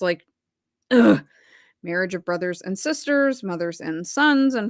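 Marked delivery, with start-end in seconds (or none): 0.9-1.2 a short, loud groan of disgust; disgusted ugh. Real, not acted. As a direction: let out a sharp "ugh" of revulsion. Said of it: "All this is so freaking nasty".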